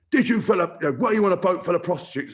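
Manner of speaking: mock interrogation from a stern senior player; stern word. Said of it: "he's given me a stern word".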